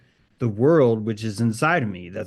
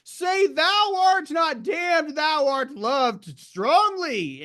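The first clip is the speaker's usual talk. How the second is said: high, slow, booming incantation; lofty wizard invocation, drawn out and high; creative wizard; much slower than usual. Acted. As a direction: proclaim it like a sorcerer casting a blessing. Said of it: "But I'm a creative wizard".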